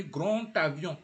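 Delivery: GRAND AVION joined by a T; D sounded as T. Shown as a demonstration. Teacher has learners repeat GRAND AVION, D as T. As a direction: link GRAND into AVION with a clear T. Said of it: "Repeat after me".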